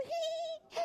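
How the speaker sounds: very high "hee" squeal; playful giggle. A narrator's reading. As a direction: giggle up high like a silly duck. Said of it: "Dudle only giggled".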